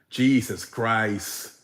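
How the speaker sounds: slow, laughing through the words; real amused laugh. Real, not acted. Laughing at his own joke.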